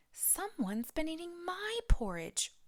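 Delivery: high, breathy mock wail; Mama Bear wailing. A narrator's reading. Mama Bear laments her eaten porridge, high mock wail. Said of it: "wailed Mama Bear".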